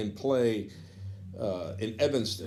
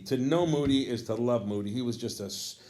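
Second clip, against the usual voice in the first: ordinary talk, nothing marked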